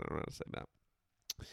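a near-silent mutter to yourself; whispered aside. Real, not acted. Host giving up on the French name, whispering. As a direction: whisper it under your breath, giving up.

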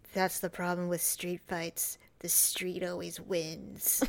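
slow, breathy gravel whisper; Vin Diesel whisper. Retold or imitated. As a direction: whisper it like a tough guy movie line. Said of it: "he says".

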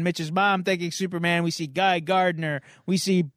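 ordinary talk, nothing marked

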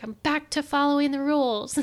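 high, jokey dutiful voice; self-mocking chirp. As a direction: poke fun at her rule-following self. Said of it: "And I'm like".

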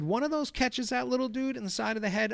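high-pitched, heated-sounding warning; retold arguing. Groundskeeper warning a dad about his boy, retold arguing. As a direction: press the point home, pitched well up.

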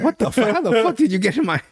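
high, alarmed outburst; mock startled yelp; startled Moran. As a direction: jolt awake in shock. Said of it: "Moran's like".

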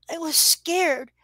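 put-on slow, high child's voice; lightly voiced frightened girl; scared little girl. A narrator's reading. Girl Casey.

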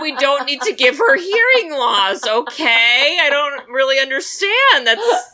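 high, loud laughing disbelief; exasperated laughing rant. Real, not acted. Friend ranting about the tuba scene, laughing in disbelief.

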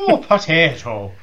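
slow, high hammed-up leprechaun brogue; drawn-out mock-Irish lilt. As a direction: put on a cartoon Irish leprechaun. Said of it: "you don't sound like a leprechaun".

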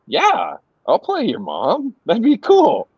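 high, breezy imitation of her yes; lightly acted enthusiasm, lilting high; retold eager agreement. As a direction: lift the pitch, happily game. Voicing the actress agreeing.

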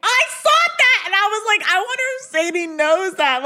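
an excited, high-pitched outburst; excited reaction. Real, not acted. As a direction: blurt it out high, thrilled to have spotted it. Excited reaction to shared discovery.

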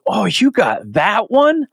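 high, slow, fawning voice of the handler; gushing handler. Retold or imitated. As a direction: coo it like an impressed assistant.

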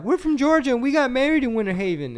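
high, loud hammed-up Southern friendliness; eager chime-in. Other table telling the diners their story, high and loud. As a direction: jump in the chat with pride.

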